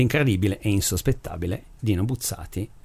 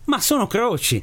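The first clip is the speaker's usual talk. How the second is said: high, astonished exclamation; surprised reply; 10 semitones higher than usual. Acted. Priest answers the aliens' question about antennas, surprised. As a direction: blurt it out as if it were obvious.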